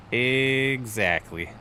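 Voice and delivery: very slow EXACTLY, first syllable stretched long; drawn-out EXACTLY. Real, not acted. Repeats the word, drawn out.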